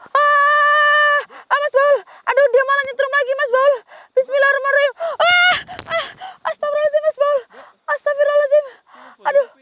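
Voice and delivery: very high, loud, strained screaming; screaming in pain. Real, not acted. Shocked by the cursed doll, screams to Mas Baul in terror. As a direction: cry out in real terror and pain.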